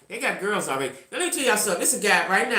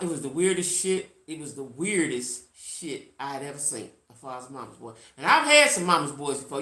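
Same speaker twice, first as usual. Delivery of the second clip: usual speaking voice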